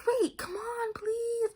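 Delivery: high, pleading whisper-light friend voice. Retold or imitated. Friend begging her to listen, in a high pleading voice. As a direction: beg like the chatty friend in class. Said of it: "my friend's like".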